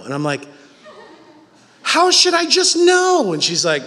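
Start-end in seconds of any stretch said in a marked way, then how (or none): 1.8-3.3 high, slow, incredulous outburst; exasperated. Retold or imitated. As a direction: throw the question back in mock outrage. Retold exasperated reply to wife.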